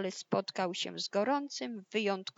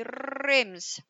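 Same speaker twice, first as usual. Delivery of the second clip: loud, higher, drawn-out impact noise; dramatic crash word; 5 semitones higher, 8 dB louder than usual. A narrator's reading. Narrator imitates wolf blowing down the house, loud drawn-out crash. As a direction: roll it out like a big crash. Wolf's blow hits the house.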